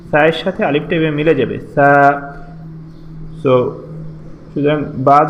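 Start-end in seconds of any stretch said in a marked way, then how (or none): 1.7-2.2 long, held "সা"; drawn-out "সা". Shown as a demonstration. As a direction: linger on the vowel of "সা". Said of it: "আমরা একটু টেনে পড়ব". (টেনে "সা")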